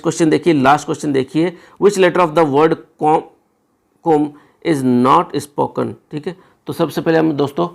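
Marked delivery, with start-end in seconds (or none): 1.8-5.9 very slow, word by word reading; slow question read; slow dictation. Shown as a demonstration. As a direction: read the question out slowly for students. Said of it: "reading the question aloud slowly".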